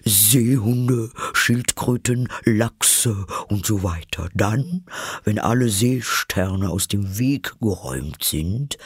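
plaintive creature voice, getting carried away; animated, wistful turtle character; eager Mock Turtle. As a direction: list it with airy, theatrical relish.

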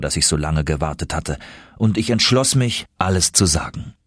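ordinary delivery

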